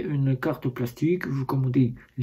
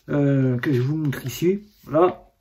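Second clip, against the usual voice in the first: normal talking voice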